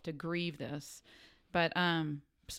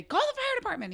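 high, louder urgent command; urgent order; 4 dB louder than usual. Retold or imitated. Mom telling her son to call, urgent high command. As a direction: bark the order in a panic.

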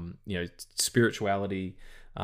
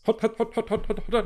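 mock siren chant; 13 semitones higher than usual. Mock SWAT raid siren.

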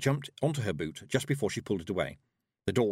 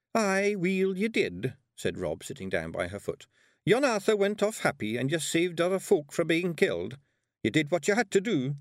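high, broad Scottish brogue of a tiny warrior; Scottish pixie voice; 7 semitones higher than usual. Acted. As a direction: play the wee blue Pictsie, bold and cheeky. Rob comforts Tiffany.

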